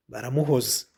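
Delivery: ordinary delivery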